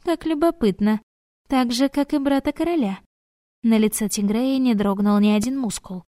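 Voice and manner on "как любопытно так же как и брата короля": cartoon princess. A narrator's reading. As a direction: chirp it sweetly, coquettish. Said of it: "прощебетала она".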